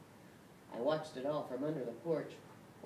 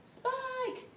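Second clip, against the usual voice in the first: yelling Spike; 5 dB louder than usual. Acted. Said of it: "yelled Joe from the porch".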